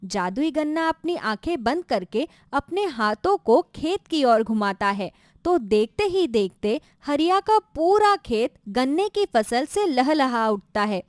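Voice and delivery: usual speaking voice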